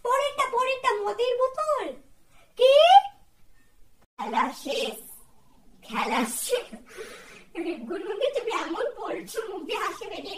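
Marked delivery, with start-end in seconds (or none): 0.0-2.7 much higher, loud yell; high shout. Acted. Shouting wrong guess over headphones. 4.2-6.7 loud, laughing call; loud announcement. Acted. Host ends game loudly.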